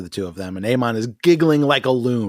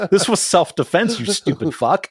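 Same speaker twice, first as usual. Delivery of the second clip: mock indignant retort; 7 semitones higher than usual. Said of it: "I'm just like".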